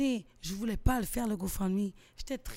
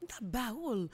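usual speaking voice

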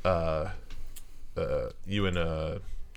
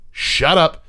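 raised, higher command; curt order; 6 semitones higher, 5 dB louder than usual. Acted. Head guard silencing his underling, loud curt order. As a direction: cut him off firmly. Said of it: "point to Smythe and he'll say".

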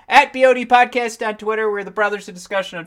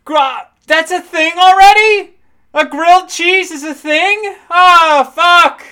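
surprised realization; 10 semitones higher, 10 dB louder, slower than usual. Retold or imitated. Imagined namer realizing with dismay.

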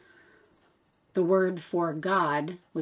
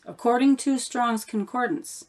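ordinary talk

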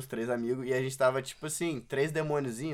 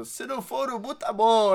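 higher, laughing voice for the friend's dad; father's retort; 9 semitones higher, 5 dB louder than usual. Retold or imitated. Friend's father teasing the narrator at the bar, higher and laughing.

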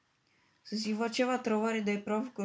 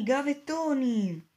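gavettoni yelled again, high and slow; second yell; 5 semitones higher, slower than usual. A narrator's reading. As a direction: keep shouting gavettoni across the hall. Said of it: "Poi qualcuno urlò". (urlato di nuovo, alto e lento)

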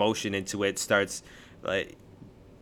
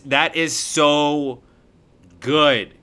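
slow, louder, drawn-out rave; emphatic raving; 4 semitones higher, 4 dB louder, much slower than usual. Real, not acted. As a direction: draw it out and land hard on the last word. Raving about Feel Away.

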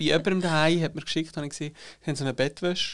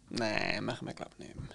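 quiet; 6 semitones lower, 12 dB quieter than usual. Retold or imitated. Quoting their own doubt after season one.

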